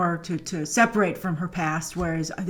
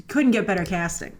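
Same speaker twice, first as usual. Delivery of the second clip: ordinary talk, nothing marked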